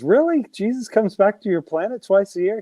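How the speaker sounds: high, incredulous question; surprised. Retold or imitated. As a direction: ask it in disbelief. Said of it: "the guy goes".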